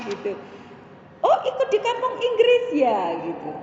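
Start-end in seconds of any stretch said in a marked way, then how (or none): 1.2-3.0 high mock-curious exclamation; retold surprised question. Another mother asks about English camp, mock surprise. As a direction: imitate a mother's high, lifted query. Imitates the other mother asking.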